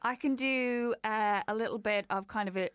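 ordinary delivery

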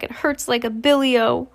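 plain reading voice

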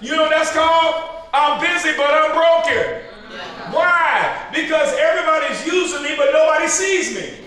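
high, shouted preaching; full-force preaching. Acted.

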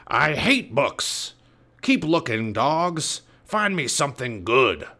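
slow, disgusted pirate voice; grumbling pirate captain. Acted. Captain Bones scorning books to crew, grumbling pirate voice. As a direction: grumble orders at your useless crew.

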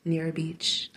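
slow, breathy whisper; hushed secret admission. Real, not acted. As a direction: confide it softly, slowly. Correcting herself softly.

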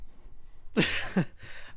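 wheezing, near-silent laughter; helpless airless giggle; breathless silent laugh. Real, not acted. [name] cracks up at the mock speech, breathless laugh.

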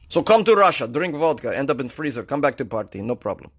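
hammed-up Russian invitation; terrible Russian accent. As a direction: pitch it like a cheesy Russian salesman. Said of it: "how terrible my Russian accent is".

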